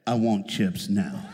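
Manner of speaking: low, slow, loud stubborn demand; mock demanding child. Naked boy insisting to Mama, low slow demand. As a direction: plant your feet and demand it.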